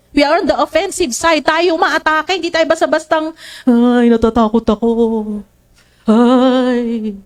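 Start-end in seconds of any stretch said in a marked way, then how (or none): 3.6-7.1 mock fearful. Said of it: "parang ganon".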